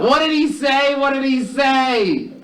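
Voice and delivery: very high-pitched screech of a wife; hammed-up high wifely yell. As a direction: yell it in a piercing falsetto, dragging the end. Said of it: "she yells out".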